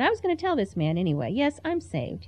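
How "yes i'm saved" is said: higher-pitched, defiant retold answer; defiant retort. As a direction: insist on it, lightly acting affronted. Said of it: "Who do you think you are?".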